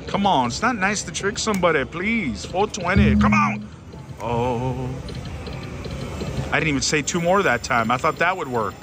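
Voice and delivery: ordinary talk, nothing marked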